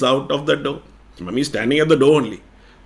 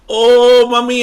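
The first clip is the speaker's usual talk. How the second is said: high, loud relieved cry; child crying with relief, high; 10 semitones higher, 11 dB louder, slower than usual. Acted. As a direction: fling yourself at mommy. Child relieved hugs mother.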